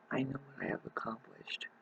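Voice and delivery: whispered to herself. Real, not acted. Vlogger whispers her own accomplishment. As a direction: breathe the words out unvoiced.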